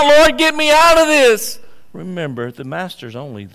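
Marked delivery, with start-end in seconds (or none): 0.0-1.6 loud, high, pleading cry for rescue; mock scream to God. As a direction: cry out to the Lord for help. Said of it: "and you start screaming out".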